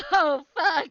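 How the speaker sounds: sudden frightened yell; screaming at a jump scare; startled scream. Real, not acted. Jump scare in horror game.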